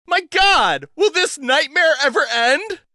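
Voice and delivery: high, loud cry of horror; horrified. A narrator's reading. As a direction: recoil in disbelief. Liz horrified at severed head.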